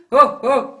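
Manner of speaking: loud short woofs; punchy chesty barks; dog bark. Shown as a demonstration. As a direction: bark out from the body. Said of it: "Jump in between".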